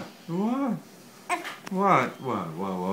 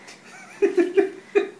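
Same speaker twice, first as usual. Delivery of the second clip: laugh; 5 dB louder than usual. Real, not acted. Laughing during froggy game.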